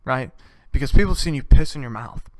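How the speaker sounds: ordinary talk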